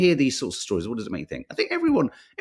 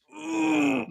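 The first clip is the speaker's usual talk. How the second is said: a rising groan like getting up; upward groan. Shown as a demonstration. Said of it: "It's a kind of upward".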